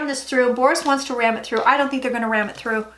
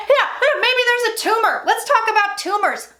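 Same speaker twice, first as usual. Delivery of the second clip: mocking, much higher sarcastic echo; sarcastic mockery; 10 semitones higher than usual. Real, not acted. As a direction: throw the doctors' idea back with scorn. Mocking the doctors' tumor suggestion.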